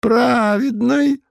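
put-on high mumbling old man voice; toothless mumble. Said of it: "ответил Путилин".

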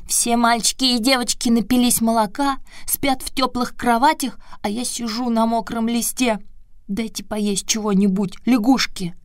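put-on high puppet boy, teeth chattering. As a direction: shiver and chatter through it. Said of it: "стуча зубами".

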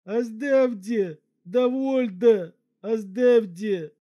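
high, slow, loud cry through the nose; nasal cry. Acted. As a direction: yelp it out through a stretched trunk. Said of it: "вскрикнул через нос".

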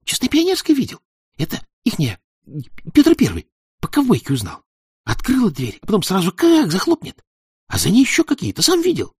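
high thin fervent voice; thin eager boy. Acted. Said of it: "ответил негромко, но горячо".

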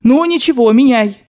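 loud, decisive order; resolute shout. A narrator's reading. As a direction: call out the decision firmly. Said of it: "крикнула она решительно".

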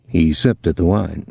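ordinary delivery, nothing marked

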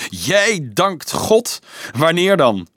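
high, drawn-out mocking disbelief; scornful. A narrator's reading. Anselmus challenges Dolf's piety before the children, scornful. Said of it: "Dom Anselmus lachte honend".

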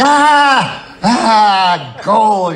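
high, loud cry of discovery; thrilled. Retold or imitated. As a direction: shout it like you just struck gold. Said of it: "and then you're thrilled".

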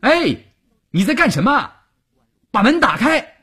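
soldier's high-pitched demand through a door. A narrator's reading. Soldier demands that the locked door be opened, loud. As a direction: raise your voice sharply and order it opened. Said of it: "士兵提高声音大声地问".

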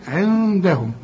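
عندهم with the noon held as a hum; nasal ghunna. Shown as a demonstration. Teacher demonstrates ikhfa to the student, held nasal noon. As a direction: hum through the ن of عندهم before the d. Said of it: "غن هنا".

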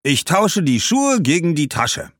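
loud, high-pitched, firm offer; bargaining shoemaker. Acted. Shoemaker offering shoes for her bag, boldly bargaining. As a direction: make your bid boldly.

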